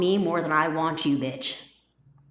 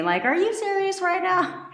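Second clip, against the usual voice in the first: much higher, disbelieving; incredulous squeal; 12 semitones higher than usual. Real, not acted. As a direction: shoot up high in disbelief. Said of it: "incredulous outburst".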